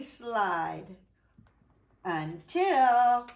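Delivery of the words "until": dramatic build. A narrator's reading. Suspense before discovery.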